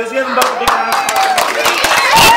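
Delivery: high, loud, slow applause call; booming showman call for a big round of applause; excited announcer. Real, not acted. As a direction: hype up the crowd for the volunteers.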